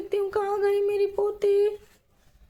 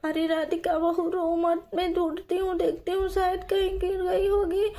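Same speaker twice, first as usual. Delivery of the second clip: ordinary delivery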